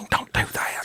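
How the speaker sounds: hushed, voiceless scolding whisper; imitated whispered warning. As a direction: act out a secret whispered telling-off. Wife's secret warning acted out.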